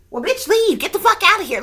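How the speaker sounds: loud, high mock outburst; sudden yell. Vlogger imitating a troll randomly yelling, loud and high. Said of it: "all of a sudden yell out".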